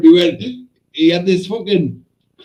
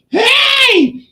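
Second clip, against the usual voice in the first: high yell into a cry; explosive shout; 4 dB louder than usual. Retold or imitated. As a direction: act out a holler cut by the bang. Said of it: "Like that".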